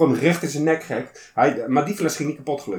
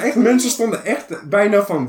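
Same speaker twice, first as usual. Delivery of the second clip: normal talking voice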